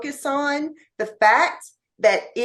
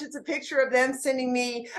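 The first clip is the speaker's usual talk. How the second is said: normal talking voice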